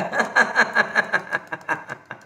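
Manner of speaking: staccato laugh tapering off; laughter exercise. Shown as a demonstration. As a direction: laugh on one breath until it fades. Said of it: "काय करायचं ते करा". (विरत जाणारं हास्य)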